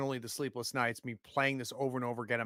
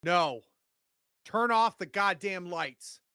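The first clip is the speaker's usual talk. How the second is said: raised, higher-pitched command; angry order; 5 semitones higher, 4 dB louder than usual. Acted. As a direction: order them sharply, fed up. Acted angry order after a shooting.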